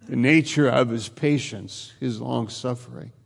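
ordinary talk

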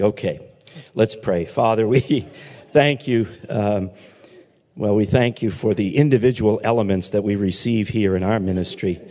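low, calm opening prayer; pulpit prayer. Acted. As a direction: lead the congregation into prayer. Leads a short prayer.

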